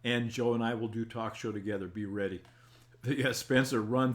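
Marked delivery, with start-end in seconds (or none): none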